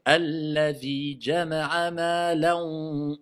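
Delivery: slow, drawn-out chanted recitation. Shown as a demonstration. Teacher recites the next verse slowly for the class, chanted. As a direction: intone it slowly, syllable by syllable. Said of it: "Pourquoi je dis pas malan?".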